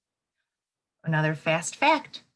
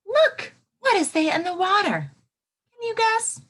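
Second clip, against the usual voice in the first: high, teasing read-aloud question; playful question; 12 semitones higher, 4 dB louder than usual. Real, not acted. Teacher teasing her class with a guessing question.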